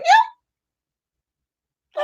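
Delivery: sudden very high "yeah"; high-pitched retort. Retold or imitated. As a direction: squeak out a sharp high yelp. Startled retort to the interrupter.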